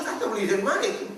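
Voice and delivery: high, loud, fast startled asking; surprised question. Retold or imitated. Preacher asking the aunt in alarm, high startled question. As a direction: blurt it out in shock.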